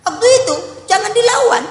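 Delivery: high, loud insistence; emphatic warning. Real, not acted. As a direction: stress it hard so it sticks. Advising husbands not to fight back.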